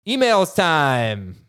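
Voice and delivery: slow, stretched-out announcing; drawn-out segment announcement. Pretend. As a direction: draw the words out like a jingle.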